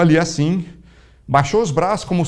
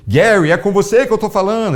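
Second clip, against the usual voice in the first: raised, ringing call of the name; preacher's emphatic call; 6 semitones higher, 4 dB louder than usual. Retold or imitated. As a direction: call him out like a prophet. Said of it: "Ele continua em inglês perfeito".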